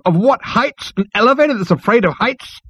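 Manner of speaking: very high-pitched disbelief; high, exasperated questioning; incredulous cry. A narrator's reading. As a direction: cry out in astonished scorn, rising at the end. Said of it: "cried Zaphod".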